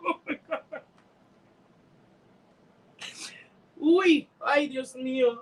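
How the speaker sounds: tearful, half-laughing through real tears; breaking down while thanking her viewers; crying. Real, not acted. Streamer thanking her viewers through tears, crying. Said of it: "I'm such an ugly crier too".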